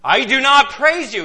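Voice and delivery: loud, high emphatic declaration; serious, emphatic rebuke. Acted. Preacher repeating Paul's rebuke so it is taken seriously, loud and emphatic. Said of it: "didn't think he was serious enough".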